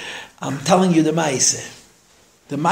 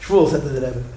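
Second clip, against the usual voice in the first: usual speaking voice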